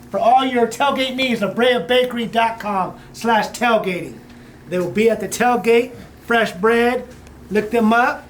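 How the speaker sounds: slow announcer ad read. Acted. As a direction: spell out the web address slowly. Ad read again.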